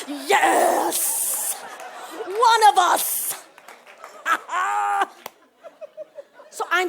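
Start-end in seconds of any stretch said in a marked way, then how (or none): 0.1-3.3 loud cheer of communal pride; celebrate, slow and loud; proud cheer. Retold or imitated. Cheering when Oprah does something, slow loud proud cheer. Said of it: "you're like". 3.4-5.3 whooping laugh. Retold or imitated. Joyful cheer laugh.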